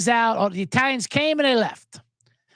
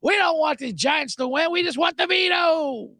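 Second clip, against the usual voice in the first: mock fan yell; 8 semitones higher than usual. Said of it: "everyone's like".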